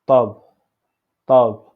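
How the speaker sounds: tab called out loud and clear; tab, loud and slow; tab said loudly. Shown as a demonstration. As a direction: announce tab firmly. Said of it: "on dit tab".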